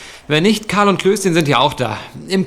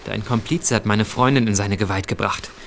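low menace; 8 semitones lower, 5 dB quieter than usual. Acted. Threatening the accomplice in bed.

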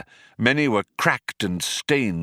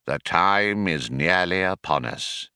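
solemn man; 5 semitones lower than usual. A narrator's reading. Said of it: "said one".

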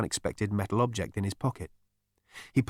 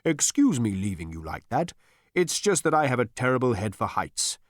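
lightly voiced apologetic spirit; mild, airy excuse-making; Gargravar; 2 semitones higher than usual. A narrator's reading. Voice apologizing to Zaphod, lightly ethereal. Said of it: "it added wistfully".

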